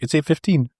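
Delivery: nonchalant. Retold or imitated. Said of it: "He's just nonchalant".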